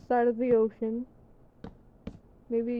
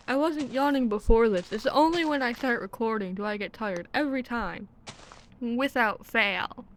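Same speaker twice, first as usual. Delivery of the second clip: ordinary talk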